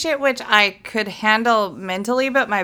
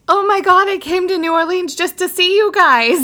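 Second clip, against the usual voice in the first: much higher, louder squeal of a fan; little excited fan voice; 9 semitones higher, 5 dB louder than usual. Retold or imitated. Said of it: "I hear this little voice say".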